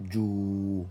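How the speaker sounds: "jou" held long on a level note; lengthened vowel. Shown as a demonstration. Teacher repeating the long vowel, "jou" drawn out. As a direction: stretch out "jou". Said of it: "sert d'outil d'allongement".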